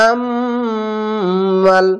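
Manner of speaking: আমাল with a long nasal hum on the মিম; slow chant with nasal ghunna; ghunna nasal hold. Shown as a demonstration. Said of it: "এখানে ওয়াজিব গুন্না কিরকম".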